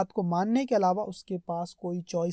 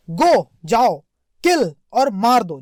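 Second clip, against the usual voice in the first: a commanding king's order, louder; 9 dB louder, slower than usual. Retold or imitated. Teacher voicing the king ordering the tailor. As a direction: bark it out as the king. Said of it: "अब राजा क्या बोल रहा है देखो".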